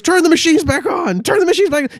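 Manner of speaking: high-pitched mock plea; desperate movie demand. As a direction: imitate a frantic old tycoon, pitched high. Retelling a ruined broker's desperate demand.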